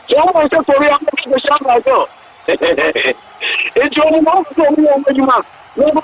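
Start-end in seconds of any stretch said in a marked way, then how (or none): 2.5-3.1 low, rhythmic chuckle. Real, not acted. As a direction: let out a low run of chuckles. Commentator laughs amid the commentary.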